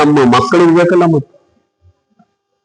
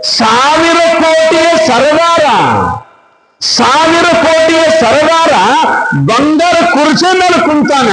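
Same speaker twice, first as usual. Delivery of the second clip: preaching high and slow; 10 semitones higher, slower than usual. Acted. Preacher builds to a high call.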